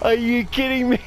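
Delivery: high, loud, slow scream breaking into laughs; scream into laughter. Real, not acted. Player caught by a jump scare, screaming and laughing. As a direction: shriek then laugh at your own fright.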